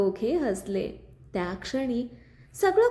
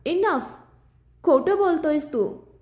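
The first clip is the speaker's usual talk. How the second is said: louder, higher angry outburst; shouting; 5 semitones higher, 4 dB louder than usual. A narrator's reading. Aryan shouting at Viraj that he lies. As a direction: shout him down. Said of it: "Aryan जोरात ओरडला".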